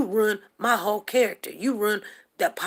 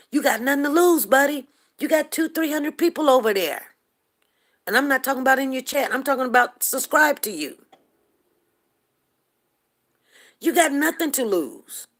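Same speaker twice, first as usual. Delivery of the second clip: louder, higher, insistent confrontation; heated challenge; 6 semitones higher, 5 dB louder than usual. Real, not acted. Streamer calling out a rival with subscribers, heated challenge. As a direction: throw it at your rival, raised and forceful.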